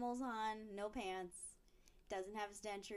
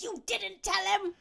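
high, loud mock scolding; hammed-up angry shriek at a husband; pissed-off wife; 8 semitones higher, 12 dB louder than usual. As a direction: yell it like an outraged wife. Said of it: "this pisses off his wife".

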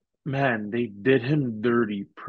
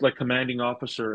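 usual speaking voice

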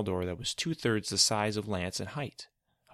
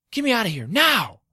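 panicked scream, much higher and louder; 12 dB louder than usual. Acted. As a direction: scream to be let out. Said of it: "screamed Lance".

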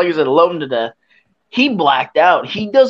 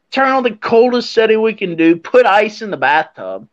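ordinary delivery

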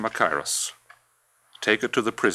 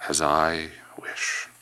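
slow whispered echo; bitter whisper; slower than usual. Acted. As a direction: breathe it out, bitterly.

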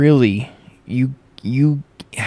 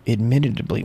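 normal talking voice

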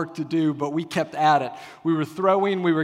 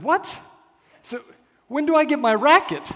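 put-on higher, louder confused child voice; puzzled little boy; 10 semitones higher, 5 dB louder than usual. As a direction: voice a bewildered six-year-old asking for his racket. Six-year-old son confused about T-ball.

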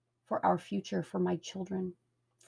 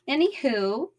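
laughing; 7 semitones higher, 8 dB louder, slower than usual. Real, not acted. Laughs after whispered aside.

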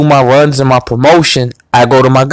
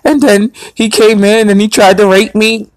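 higher, mimicked victim's account; imitated upset woman; 7 semitones higher than usual. Girl reporting the attack to him, mimicked distress. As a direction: put on her distressed voice lightly.

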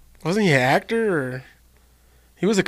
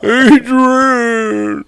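long, loud high wail; Rocky; 8 semitones higher, 6 dB louder than usual. Acted. Showing off his movie impression to friends, Rocky. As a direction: draw the name out in a high, loud cry. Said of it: "I can do Rocky".

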